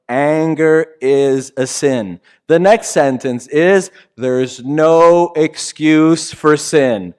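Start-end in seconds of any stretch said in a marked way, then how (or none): 0.1-2.2 slow, deliberate, Anger stressed; slow self-reminder. Shown as a demonstration. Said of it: "I tell myself". 4.2-7.1 slow, deliberate, "no" pressed; stress on no. Shown as a demonstration. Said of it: "Can we say that together?".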